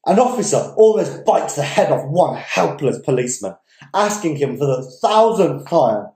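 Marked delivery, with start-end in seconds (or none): none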